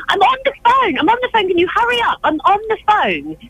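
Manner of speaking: high-pitched, put-on impatient calling out. Reporter on a phone pesters cafe staff, loud put-on demand. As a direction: call across the counter, high and insistent. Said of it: "say it louder and say".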